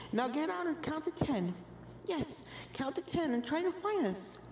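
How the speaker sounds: put-on high, soft childlike ghost voices; eerie ghost twins. A narrator's reading. Ghost twins inviting Apple Bloom to hide and seek, high and soft. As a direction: voice the twins high and hushed, echoing each other.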